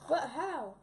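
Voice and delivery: ordinary talk, nothing marked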